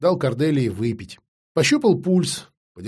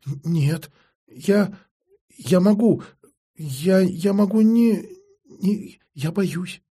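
high, halting stammer of fear; frightened stammer; 6 semitones higher, much slower than usual. Acted. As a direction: stumble over words, scared.

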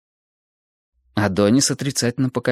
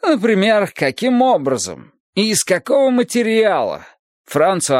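lightly voiced teacher, higher than the narration; Adonis expounding; 7 semitones higher than usual. Adonis explains the code of human simulation, raised lecturing voice. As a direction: expound it in a lifted, insistent lecturing voice.

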